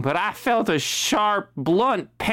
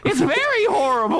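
horrible voice; 11 semitones higher, faster than usual. Acted. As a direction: lean into the terrible voice. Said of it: "Oh, God, it's horrible".

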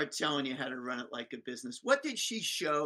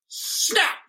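snap; 9 dB louder than usual. Retold or imitated. Imitated trap closing.

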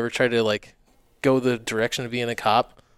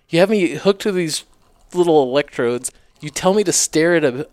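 louder, higher retold complaint; exasperated protest; 5 semitones higher, 6 dB louder than usual. Applicant disputes an inconclusive polygraph with HR, mildly exasperated. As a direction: argue the case with rising exasperation.

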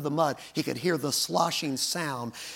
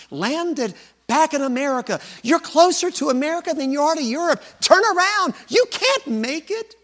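higher, louder pleading voice of doubt; voice of doubt rising; 12 semitones higher, 7 dB louder than usual. Retold or imitated. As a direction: push harder, turn around. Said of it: "The voice".

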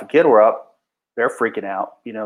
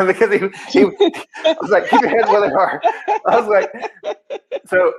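higher-pitched, words breaking into real laughter; laughing; 9 semitones higher than usual. Real, not acted. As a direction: crack up while retelling it. Cracks up retelling the groin wound.

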